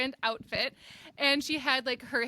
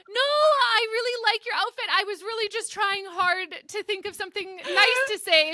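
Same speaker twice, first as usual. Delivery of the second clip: high, loud and flustered; half-laughing panicky over-explaining; flustered protest; 7 semitones higher, 3 dB louder than usual. Retold or imitated. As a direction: scramble to take it back. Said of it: "And I was like".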